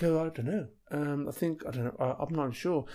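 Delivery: soft, hesitant, trailing off; unsure friend mumbling he doesn't know; unsure mumble. Retold or imitated. Astronaut friend unsure how to get home, mumbling softly. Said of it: "He'll be like".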